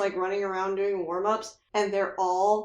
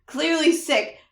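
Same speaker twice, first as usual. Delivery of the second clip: loud, raised mock rant of a mean coach, voice cracking with laughter; angry coach; 5 semitones higher, 6 dB louder than usual. Friend plays the coach scolding his sick son, laughing loudly. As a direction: berate the kid like a ranting coach through laughter. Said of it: "He's like".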